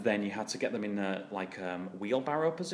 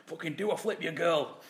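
raised, higher mock coach bark; gruff trainer yell; 8 semitones higher, 7 dB louder than usual. Old school trainer barking at girls to flip.

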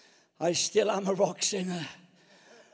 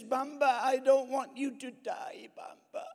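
higher-pitched crying little girl, hammed-up; mock tearful child; 6 semitones higher than usual. Granddaughter begging her grandpa not to die, mock tearful. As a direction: imitate a child pleading through tears. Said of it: "she starts crying".

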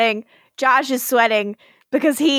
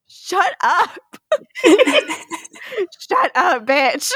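laughing shut up; 6 semitones higher, slower than usual. Real, not acted. Laughing at Klaus.

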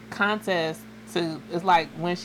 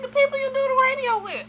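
much higher and quick, as the mother; mimicking mom; 3 dB louder, faster than usual. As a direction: play the mom explaining. Mother voiced high.

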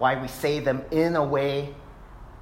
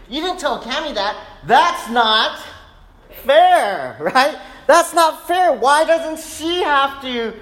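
put-on high, whining child's complaint; whiny kid; 10 semitones higher, 6 dB louder than usual. Pastor mimicking his son complaining, whiny high kid voice. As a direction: mimic a sulky boy protesting, voice climbing. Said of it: "he'll often say".

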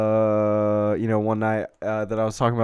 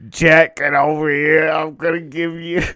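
put-on Boston accent; 4 semitones higher, 5 dB louder than usual. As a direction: call the kid over, Boston style. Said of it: "But I was doing this Boston accent".

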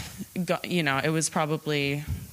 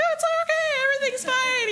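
high, bright cheerful reassurance; mock upbeat pal brushing off the storm; enthusiastic; 3 dB louder, faster than usual. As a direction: imitate a chirpy friend saying all is well. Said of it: "enthusiasm as per usual".

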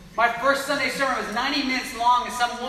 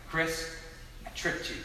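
sheepish, hushed admission; nervous confession; 5 semitones lower, 9 dB quieter than usual. Retold or imitated. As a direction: own up softly, pitched low. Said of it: "I'm kinda nervous".

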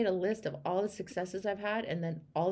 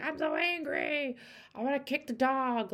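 mock angry; 6 semitones higher than usual. Coach mocking an angry client, hammed-up fury. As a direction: ham up being furious. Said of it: "become angry and be like".

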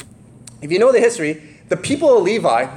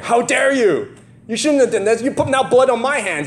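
blaming father; 7 semitones higher than usual. Retold or imitated.

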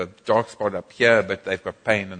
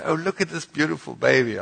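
mock gushing, a bit higher; gushing admirer; 6 semitones higher than usual. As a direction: imitate people cooing over a baby. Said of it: "So people say".

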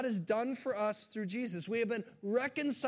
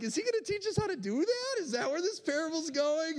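higher, mocking congregant voice; wry listener; 8 semitones higher, 4 dB louder than usual. Preacher mimicking his congregation, mock incredulous. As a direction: voice a sceptical listener, tongue in cheek. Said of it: "Some of you are like".

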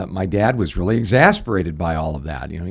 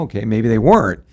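ordinary talk

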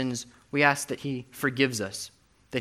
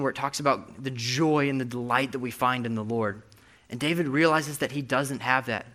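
usual speaking voice

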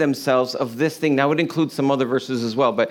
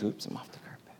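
whispered aside; 6 semitones lower, 19 dB quieter, faster than usual. Real, not acted. Aside off the mic.

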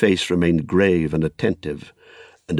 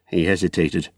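plain reading voice

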